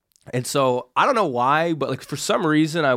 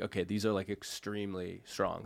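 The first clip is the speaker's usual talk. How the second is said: much softer and lower, slowing down; awed hush; 4 semitones lower, 14 dB quieter than usual. Retold or imitated. Tripping man realizes how strong the chocolates are, awed hush. Mushrooms hitting hard.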